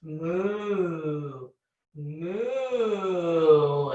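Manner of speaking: a playful moo, not far from her own voice; cow moo. Shown as a demonstration. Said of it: "We need to be more noisy".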